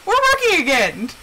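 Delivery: high, playful voice for a machine; chirpy computer voice. Retold or imitated. Wife voicing gadget that works for her, mock chirpy. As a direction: announce it brightly as the revived gadget.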